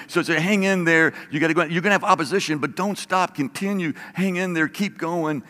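rally them to keep going; a pastor's rousing push to hang in there; urging on. Real, not acted. Pastor urging listeners to persevere.

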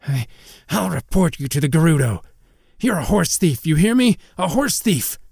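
high, blustering accusation; stammer, then cry it out in outrage; indignant outburst climbing in pitch; plucked-up courage. A narrator's reading. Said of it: "seemed to have plucked up some courage".

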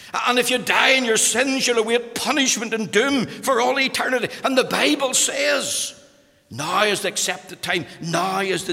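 loud, high, urgent warning; fervent hellfire delivery; preaching at full force. Acted. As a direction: thunder the warning from the pulpit.

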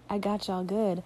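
ordinary talk

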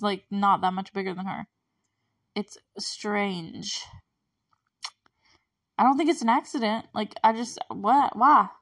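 ordinary talk

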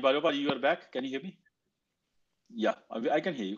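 ordinary talk, nothing marked

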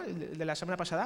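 usual speaking voice

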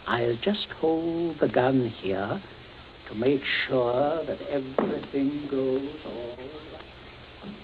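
very slow, softening threat; measured menace. Acted. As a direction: draw the words out, fading softly. Menacing him with the gun.

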